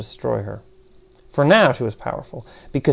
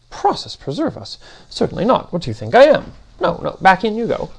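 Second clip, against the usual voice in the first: high, swooping voice of an affronted machine being; affronted, higher-pitched character voice; exasperated Demiurge; 8 semitones higher, 4 dB louder than usual. Acted. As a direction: dismiss the very idea, pitch leaping up.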